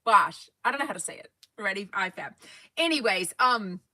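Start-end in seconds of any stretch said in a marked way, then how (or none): 0.0-0.5 a louder "Wash", short and no r; louder "wash" try. Shown as a demonstration. Vlogger giving "wash" a final go. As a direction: punch out "Wash" once more. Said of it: "I can't say wash".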